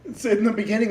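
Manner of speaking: ordinary delivery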